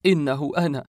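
contained, aching first declaration; anguished. A narrator's reading. Narrator embracing his father, restrained anguish. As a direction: say it heartsick, holding back the full cry. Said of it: "احتضنته وأنا أقول ملتاع القلب".

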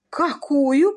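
high, loud rising question; interested query. A narrator's reading. Lady questions her brother with high, loud interest. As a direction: ask with heightened interest. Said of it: "поинтересовалась леди Джулия".

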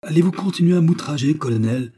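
high, loud outraged question; indignant challenge. A narrator's reading. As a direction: confront him with wounded dignity. Indignant woman confronts her captor.

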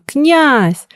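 high, urgent call; desperate cry. A narrator's reading. The groom calls the prince from the stairs, desperately. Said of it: "опять отчаянно крикнул Грум".

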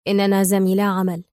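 plain reading voice

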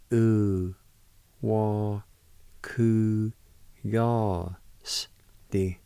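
"U, wa, ku, ya, s, di" said one syllable at a time with pauses. Shown as a demonstration. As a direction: sound out each syllable of "U, wa, ku, ya, s, di" separately. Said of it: "It's pronounced like this".

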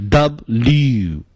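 "W" as dub-lyoo with long final oo; slow model "W", double and U merged; run-together W. Shown as a demonstration. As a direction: stretch the end of "W". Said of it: "把它念顺口了就成了".

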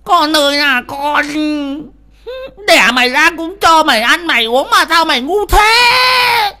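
loud, very high lament; tearful wailing. A narrator's reading. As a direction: wail at the heavens over your foolish son. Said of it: "mà mếu máo". (gào mếu máo than thân trách con)

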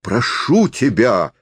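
high, drawn-out desperate plea; cry in unnatural voice. A narrator's reading. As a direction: beg in a cry not your own. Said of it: "не своим голосом крикнул Карасёв". (отчаянно, не своим голосом)

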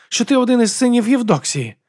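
high-pitched irritated retort; snapping. A narrator's reading. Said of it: "огризнулася Алекс".

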